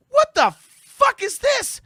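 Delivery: very high, loud, slow outburst; incredulous high-pitched retold yell; appalled disbelief. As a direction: squeal it in mock outrage, drawn out. Acts out a listener's appalled reaction.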